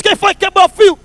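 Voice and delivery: high-pitched mock angry demand; angry doorman. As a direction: play the cross doorman catching the culprit. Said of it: "Nervoso, brabo".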